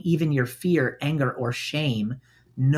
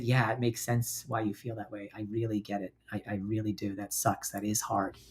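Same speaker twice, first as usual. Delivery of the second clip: much softer and lower, gentle and understanding; soft sympathetic reassurance; 5 semitones lower, 11 dB quieter than usual. Shown as a demonstration. Comforting a friend about her boyfriend, very soft and warm. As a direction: soothe a friend who is hurting. Kind friend response demo.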